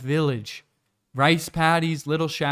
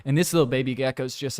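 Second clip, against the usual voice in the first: usual speaking voice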